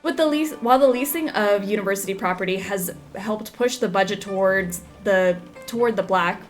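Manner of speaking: newspaper article read aloud; news read. Acted. Reads in-game news article.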